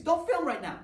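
sudden high, loud mock reprimand; mock scolding. Teacher to student filming, loud mock scold. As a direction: snap at the kid with the phone, hammed-up.

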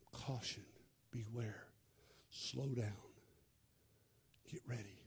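low, soft, near-whispered; quiet warning. Acted. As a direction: warn them gently, almost under breath. God warns of danger ahead.

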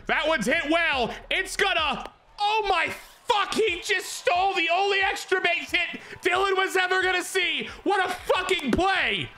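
high shouted disbelief at the catch; stunned shout. Real, not acted. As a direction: yell out in shock as the hit is robbed. Outfielder robs his hit.

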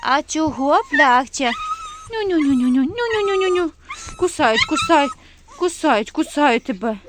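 loud high cooing with a nyu-nyu-nyu. Real, not acted. Woman comforts a crying, tick-bitten puppy in loud high baby talk. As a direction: soothe the crying puppy in sing-song.

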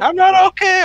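high, loud squeal; squeaky panic. Acted. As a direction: shriek it in mock panic. Player squeals a panicked reply.

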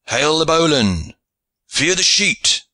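high, carrying shipmaster's call; drawn-out seaman's command; Goodwin Hawtayne's orders. A narrator's reading. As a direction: call orders across a deck, pitch lifted. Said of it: "the voice of Goodwin Hawtayne".

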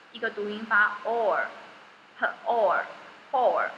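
slow drawn-out "or, or, or"; repeating the sound or. Shown as a demonstration. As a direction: stretch "or" slowly three times. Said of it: "O R我们会". (慢慢重复发"or"音)

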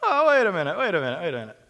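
high mock surprise, fading; mock-realize something, sarcastically; sarcastic. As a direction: pretend to suddenly notice, sarcastic. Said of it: "this little sarcastic kind of".

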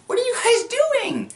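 high-pitched squeal of a question; mock sister voice. Sister walks in on the brothers, mock high voice.

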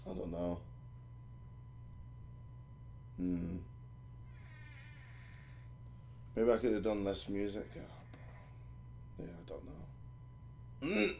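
low, soft, trailing mumbles; quietly deflated, giving up; demoralized. Real, not acted. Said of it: "I'm just so demoralized".

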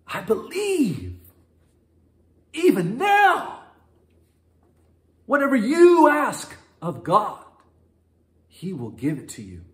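put-on high, slow, pleading like a child; childlike request. Martha asking like a child asks a parent, high and slow. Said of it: "from a child requesting something from their parent".

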